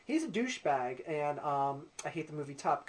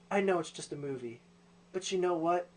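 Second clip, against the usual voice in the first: ordinary delivery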